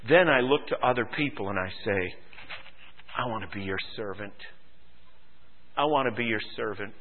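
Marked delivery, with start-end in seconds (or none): 3.2-6.9 soft, low and quick; humble offer. Acted. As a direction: offer yourself gently, almost under the voice. Acted humble offer to others.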